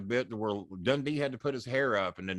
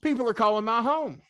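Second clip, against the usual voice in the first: mock hype announcer, pitched higher; 11 semitones higher, 5 dB louder than usual. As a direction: sell it like a live TV tease. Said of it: "they say".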